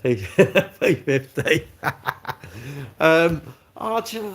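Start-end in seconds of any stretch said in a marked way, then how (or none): 0.2-0.8 loud burst of laughter; real laugh. Real, not acted. Streamer cracking up while reading the chat, real laugh. 1.7-2.9 soft laugh tailing away; real laugh. Real, not acted. Streamer still amused by the chat, real soft laugh.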